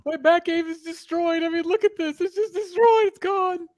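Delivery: high, whiny lament over the ruined bat cave; mock grief. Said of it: "I'm so sad".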